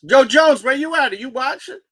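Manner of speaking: loud, high call to a friend. Real, not acted. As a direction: shout out to someone watching. Calls out to absent friend.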